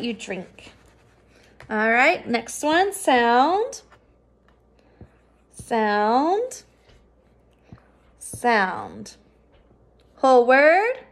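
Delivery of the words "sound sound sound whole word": each sound drawn out with a rising tune, then the word. Shown as a demonstration. Teacher leading the class sound by sound, rising each time. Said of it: "read these sounds with me".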